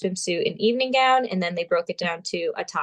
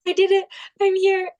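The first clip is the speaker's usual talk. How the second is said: much higher and louder, bursting with joy; overjoyed inner voice; 12 semitones higher, 5 dB louder than usual. Retold or imitated. Pageant contestant reliving her onstage thrill, excited and high. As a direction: let the triumph spill out, teary and thrilled. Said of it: "And I'm like".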